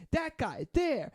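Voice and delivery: high, slow drawn-out point; drunk guy pointing. Retold or imitated.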